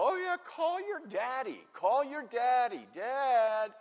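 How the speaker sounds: high, slow singsong jeer; mocking bullies. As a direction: mimic a crybaby to taunt them. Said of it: "you could hear them laughing".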